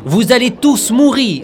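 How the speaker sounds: slow, loud doomsday shout; unhinged villain. Acted. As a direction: bellow the doom like a madman. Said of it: "euh, vas-y mollo!".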